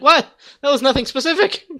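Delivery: high, loud confused protest; baffled outcry. Pretend. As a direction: protest in shock. Quoting baffled guy.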